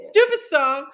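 high, loud tearful outburst; mock-annoyed through tears. Real, not acted. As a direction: blurt it out through real tears. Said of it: "I really am crying".

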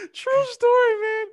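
words through loud laughter; laughing. Real, not acted. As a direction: laugh while saying it. Laughing after the punchline.

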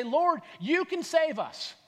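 high, drawn-out appeal; yelling over wind. Acted. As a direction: call out anxiously over the waves. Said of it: "yelling over the wind".

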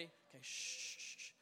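whispered shh to quiet the room; soft whispered shushing; hushing whisper. Real, not acted. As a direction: hush everyone before the bit. Pretends to be alone, hushing.

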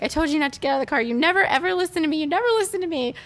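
high, fast, loud outburst; angry yelling. Retold or imitated. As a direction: yell it at her mother. Lizzie freaking out at mom.

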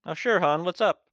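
sweet small-town bartender; Eileen the bartender. Acted.

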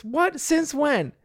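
high-pitched, startled questions; incredulous dad. Retold or imitated. Bandit reacts to the laughing girls, incredulous. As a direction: act out a dad taken aback. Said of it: "they laugh like crazy at this".

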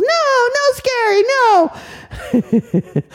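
put-on very high childish protest; scared little refusal. Little girl refusing anything scary, very high. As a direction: protest like a timid toddler.

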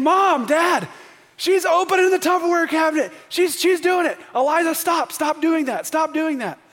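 loud, high imitation of an outraged little boy; crying out. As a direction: cry out to your parents like a tattling kid. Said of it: "he's crying out for justice".

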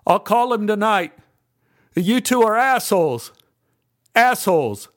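angry shout over shoulder; shouted back. A narrator's reading. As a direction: call it back down the hall. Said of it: "He shouted back".

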